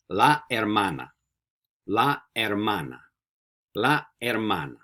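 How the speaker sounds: "La hermana" with no H sound, la er-MA-na; silent H. Shown as a demonstration. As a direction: model "hermana" starting straight on the vowel. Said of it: "Remember, no H's".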